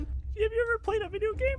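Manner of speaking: high, mocking question; teasing. Real, not acted. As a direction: tease them for not getting it. GM teasing the players.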